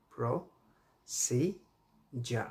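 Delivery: প্রসিজার split slowly as pro, si, jar; broken into syllables. Shown as a demonstration. Teacher breaking প্রসিজার into syllables, very slowly. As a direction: drag out each syllable of প্রসিজার. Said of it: "মানে ভেঙে ভেঙে".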